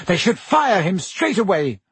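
high, upset protest; tearful outburst. A narrator's reading. Pansy demanding Hagrid be fired, in tears. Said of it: "who was in tears".

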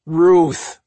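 frustrated growl. A narrator's reading. Brother calling out his sister, frustrated growl. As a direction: bark the name in frustration. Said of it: "Mike growled in frustration".

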